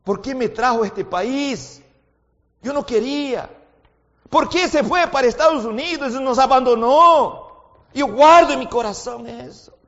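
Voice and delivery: loud, high pleading sermon voice; fervent pulpit exhortation; impassioned preaching. Acted. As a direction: hammer the point home to the congregation. Preacher voices grievances, then exhorts.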